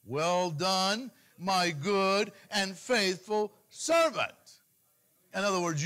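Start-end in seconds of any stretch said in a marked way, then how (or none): 0.0-4.6 loud, drawn-out voice of God; God's commendation. Acted. God welcomes a believer into heaven, slow loud commendation. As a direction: proclaim it grandly, word by slow word. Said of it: "what do you wanna hear?".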